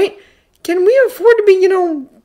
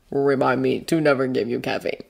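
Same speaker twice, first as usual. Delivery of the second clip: low, hushed dry remark; softly spoken, deep-voiced character line; quiet remark; 7 semitones lower, 7 dB quieter than usual. A narrator's reading. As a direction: say it low and soft, under the breath. Adult's dry remark to excited boy.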